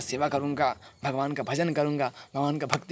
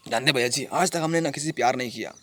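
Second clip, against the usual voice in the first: ordinary delivery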